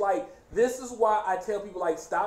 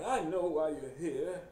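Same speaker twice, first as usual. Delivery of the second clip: softer, labored villain voice; Mister Price; 3 semitones lower, 8 dB quieter than usual. Retold or imitated. Villain confronting Elliot's friend, labored voice. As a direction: struggle through it as if mid-stroke. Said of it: "he sounds like he's constantly having a stroke".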